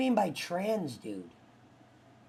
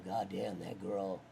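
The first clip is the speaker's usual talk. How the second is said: low creepy imitation; 11 semitones lower, 12 dB quieter, slower than usual. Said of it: "he'd go".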